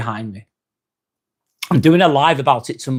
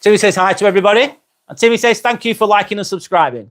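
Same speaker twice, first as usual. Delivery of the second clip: lightly voiced Timmy, pitched higher; a higher little-buddy voice for Timmy; introducing the sidekick Timmy to viewers, higher voice; Timmy's voice; 7 semitones higher, 3 dB louder than usual. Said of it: "And this is Timmy".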